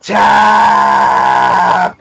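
drawn-out acted yell; mock scream. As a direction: hold one long mock scream on the word. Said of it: "still screaming".

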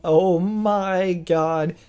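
rising, slow, laughing astonishment; excited disbelief. Real, not acted. Gamer reacting to the anime reveal, real excited laughter.

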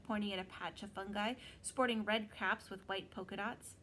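usual speaking voice

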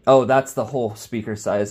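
ordinary delivery

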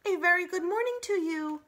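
robot calling across the pond, booming and friendly. Acted. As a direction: boom it across the water cheerfully. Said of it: "friendly voice boomed over the water".

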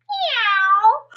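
high little meow; frog meowing. Acted. Frog tries his croak but meows, high.

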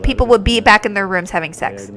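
ordinary talk, nothing marked

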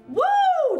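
usual speaking voice

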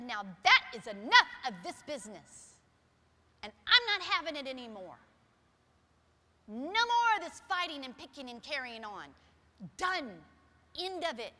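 loud, stern telling-off; loud stern scolding to end the fighting; firm scolding. Retold or imitated. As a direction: lay down the law, no arguing. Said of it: "But I didn't say it like that".

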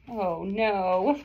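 ordinary delivery, nothing marked

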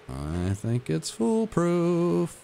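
high, very slow sing-song; mock confident. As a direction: draw it out, faux-confident. Repeated, higher and drawn out.

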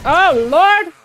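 high, loud cry of alarm; alarmed exclamation. Real, not acted. Player alarmed while dying in a fight, crying out.

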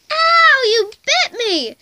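high, loud yelp of pain; pained cry. Acted. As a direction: yelp after being bitten. Cat bitten.

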